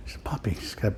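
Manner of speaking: hushed excuse for the puppy; soft whispered aside. Real, not acted. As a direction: whisper it, almost no voice. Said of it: "whispered aside about puppy".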